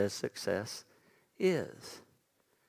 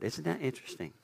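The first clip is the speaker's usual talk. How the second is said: ordinary delivery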